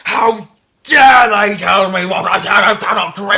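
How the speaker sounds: high, very loud hammed-up indignation; mock outraged shout. Drinker objecting to Dry January, mock outraged shout. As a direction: yell it as an affronted drinker in a parody rant.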